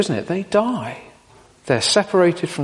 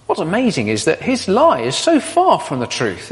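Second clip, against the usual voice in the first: normal talking voice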